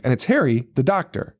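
ordinary talk, nothing marked

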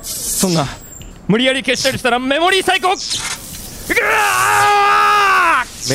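a courier screaming while his memory is erased; panic into scream. Acted. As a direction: beg fast, then scream as it hits.

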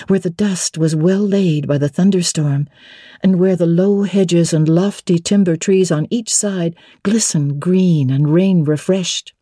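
ordinary delivery, nothing marked

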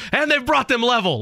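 high-pitched soccer commentator's call; excited play-by-play cry; broadcast goal call. Shown as a demonstration. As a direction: call the tying goal live on air, pitch jumping right up. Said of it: "I only use it during a broadcast".

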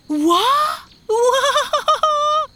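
very high, loud shriek; startled weaver's high yell; shocked shout. A narrator's reading. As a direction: shriek in disbelief, drawing it out. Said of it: "the weaver shouted".

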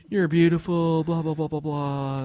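tossed-off mock wooing; mock flattery. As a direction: sing out the sweet talk dismissively. Mocks creepy flattering texts.

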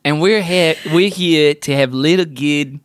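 badly faked Aussie accent; Australian accent. Host introducing podcast to listeners, mock Australian accent. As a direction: squeeze the vowels into mock Australian.

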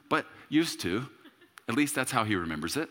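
usual speaking voice

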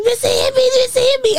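put-on high whiny kid tattling; mock high whimper of a slapped little girl; whining kid. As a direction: whine it high like a hurt child telling on her sister. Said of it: "You was like".

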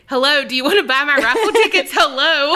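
put-on fast, high, eager kid pitch; kid selling raffle tickets. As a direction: play the eager kid at the door. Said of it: "And I'm also the kid".

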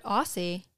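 Aussie with an S sound; habitual pronunciation. Shown as a demonstration. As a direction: say Aussie as "AW-see". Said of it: "I've always said".

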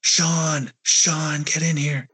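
retold scream. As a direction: call out in a fright, not a full scream. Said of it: "I just start screaming for my brother".

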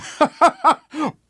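high gleeful cackle; old lady cackle. Acted. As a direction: cackle like a wicked granny. Old couple laughing at crime.

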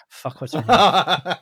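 slipping briefly into Irish; accidental Irish accent. Real, not acted. Podcaster forgets the teacher's name, slipping into Irish. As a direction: let a hint of Irish creep in. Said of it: "I went Irish there".

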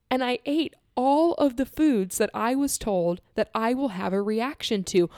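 ordinary delivery

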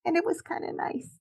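high soft tender tone. Real, not acted. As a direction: say it softly, touched. Tender memory, voice soars.

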